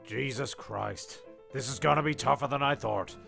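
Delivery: ordinary talk, nothing marked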